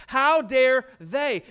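very slow, loud, drawn-out outrage; indignant how dare they. Retold or imitated. Preacher mocking the sinner's outrage, drawn out and loud. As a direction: stretch out the mock outrage.

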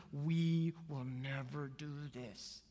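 very slow, dug-in refusal; stubborn defiance. Acted. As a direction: drag out each word, refusing to budge. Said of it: "they dug on their heels even tighter".

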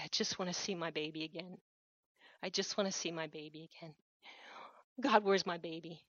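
hushed, low imitation of wailing; retold wail. Preacher retelling a grieving mother's plea, subdued mock wail. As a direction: quote her grief softly and low, without really wailing. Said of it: "and just wail".

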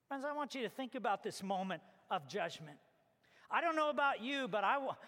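ordinary delivery

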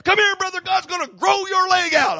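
high, loud shouted altar call; wild evangelist. Retold or imitated. Preacher imitating the evangelist calling the one-legged man, high shout. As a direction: call out to the man like a frenzied healer. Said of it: "he's crazy".

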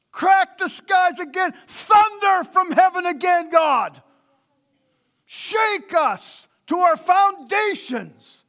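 loud, high, pleading cry to God; fervent shouted prayer. Acted. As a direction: preach it at the top of your voice. Preaching a prayer at full force.

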